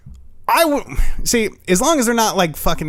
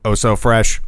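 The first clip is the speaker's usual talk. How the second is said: much lower, booming alien announcement; alien on a PA system; 10 semitones lower than usual. Pretend. Alien ship calling down to the house, low loudspeaker voice. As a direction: boom it down like a spaceship loudspeaker. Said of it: "What are they on a fucking P A system".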